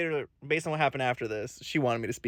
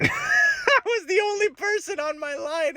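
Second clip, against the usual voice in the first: laughing; slower than usual. Real, not acted. Laughing at own story.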